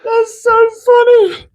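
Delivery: high, slow, breathless with laughter; laughing. Real, not acted. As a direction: still cracking up. Laughing fit.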